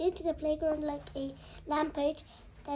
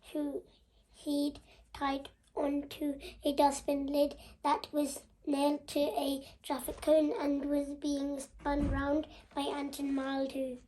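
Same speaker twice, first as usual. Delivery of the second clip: ordinary delivery, nothing marked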